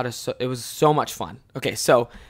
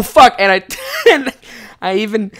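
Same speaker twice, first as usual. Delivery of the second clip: laughing; 8 semitones higher, 10 dB louder than usual. Real, not acted. Cracking up at own story.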